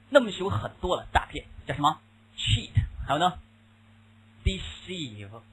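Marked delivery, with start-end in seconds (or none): none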